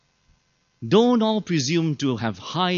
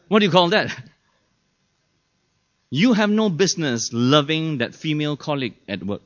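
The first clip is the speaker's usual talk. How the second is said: ordinary talk, nothing marked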